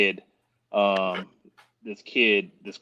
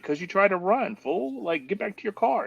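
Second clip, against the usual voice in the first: high exasperated scolding; 11 semitones higher than usual. Retold or imitated. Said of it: "And I said".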